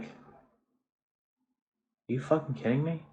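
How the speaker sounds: retold stunned disbelief at the lying driver; incredulous. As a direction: stretch it out in disbelief. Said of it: "And we're like".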